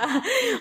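a short, high laugh that falls away; real burst of laughter. Real, not acted. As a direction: laugh at your own joke. Laughs at her [name] joke. (risa breve y aguda que cae)

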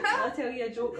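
high, loud mocking nurse voice. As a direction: mimic the offended nurse.

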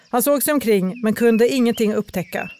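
usual speaking voice

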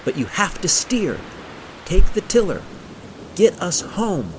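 high, forceful shouted commands; shouting over wind. A narrator's reading. Gunhild urging her friend to take the tiller, shouting. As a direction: urge her on over the roar. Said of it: "shouted Gunhild over the roar of the wind".

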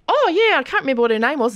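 high, loud comic sketch voice; imitating Magda's character. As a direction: ham up a chatty comic character. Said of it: "and she'd go".

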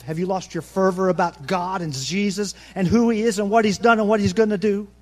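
ordinary talk

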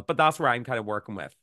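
usual speaking voice

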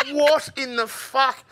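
high, slow disbelieving what; stunned what. Retold or imitated. Watching the horse bolt, slow high disbelief. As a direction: draw out the shock. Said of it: "I went".

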